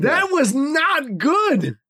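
very slow, high THAT WAS NOT GOOD; emphatic repeat. Real, not acted. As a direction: drag out every word of "That was not good", high-pitched.

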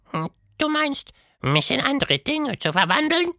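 sehr hohe, leise Monsterstimme; grübelnd nachfragen; Quatschmonster, nachdenklich. A narrator's reading. Quatschmonster antwortet. (Quatschhund überlegt laut)

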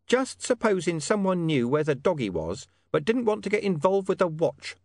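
high, cagey informant voice. Acted. Hidden informant hinting to William about the dog, high and cagey. Deep Bone hints at deal.

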